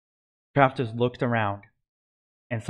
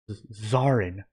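stammering on the first sound; slower than usual. A narrator's reading. Hades recognises the supposedly dead Zarin, stammering. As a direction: catch on the Z, then get the name out. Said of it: "he stammered".

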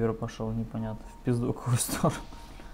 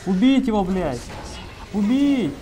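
loud high battle yell; urgent shouted command; 7 semitones higher, 7 dB louder than usual. Real, not acted. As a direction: bark the order to kill. Yelling orders in a fight.

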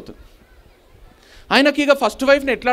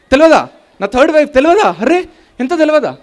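mock boasting; 4 semitones higher than usual. Acting a showy property owner.

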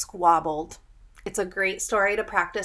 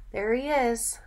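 usual speaking voice

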